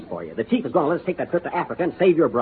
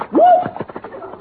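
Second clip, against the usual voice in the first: startled yelp; 3 dB louder than usual. Acted. Tripped by gazelles.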